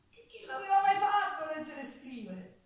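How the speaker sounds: loud, bright exclamation with a laugh before; amazed pride. Acted. Servant girl marvelling at the countess's lessons, loud proud exclamation. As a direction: burst out in delighted disbelief.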